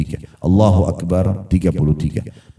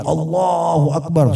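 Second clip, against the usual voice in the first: imitated battle cry; 7 semitones higher, slower than usual. Preacher imitates a companion crying out from horseback, mock shout. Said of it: "dia teriak".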